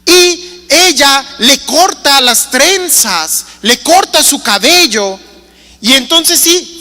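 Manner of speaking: ordinary talk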